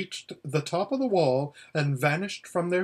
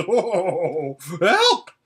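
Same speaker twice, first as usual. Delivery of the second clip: startled cry leaping very high and loud; panicked shriek; 4 semitones higher, 6 dB louder than usual. Acted. Horse feels lion's whiskers on his ear, panicked shriek. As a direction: break off into a shrill yell for help. Lion tickles Bree mid-laugh.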